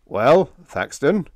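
slow, rising uncertain question; drawn-out, doubtful query. A narrator's reading. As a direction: ask it loudly but unsure.

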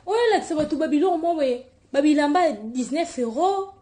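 mock her cheap clothes, quick. Real, not acted. (kotiola na mongongo likolo)

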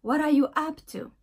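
fuse "What are" into one word, punched up; contracted What are. Shown as a demonstration. Teacher shows how the words contract, "What are" merged as "wadda". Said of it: "se contractent ensemble et ça devient".

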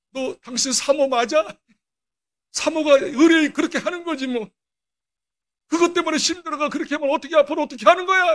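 retold scolding. Pastor rebuking his weeping, exhausted wife, retold scolding. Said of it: "막 야단을 한 거예요".

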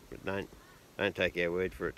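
ordinary talk

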